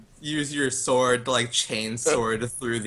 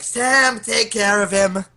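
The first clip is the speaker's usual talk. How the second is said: raised, higher call to a comrade; yelling out; 6 semitones higher, 4 dB louder, much slower than usual. Acted. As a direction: call the order across the floor, drawn out. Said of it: "I just yell out".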